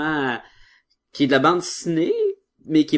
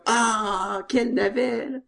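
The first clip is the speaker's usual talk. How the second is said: mock swooning; 10 semitones higher, slower than usual. Mocking TV drama reaction.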